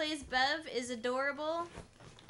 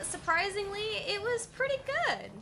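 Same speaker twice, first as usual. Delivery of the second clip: surprised; 9 semitones higher than usual. Real, not acted. Surprised verdict on movie.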